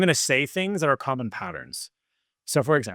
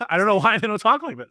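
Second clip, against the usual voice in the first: real laughing disbelief, pitched up; amused bafflement; 7 semitones higher, 3 dB louder than usual. Real, not acted. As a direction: laugh into the line, higher pitch. Laughs at his own bafflement.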